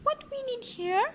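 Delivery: high, bright lady's voice; Missus Armitage voice. A narrator's reading. Parent reading aloud, voicing Missus Armitage, high and prim. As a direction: lift the voice up for the lady. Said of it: "said Missus Armitage".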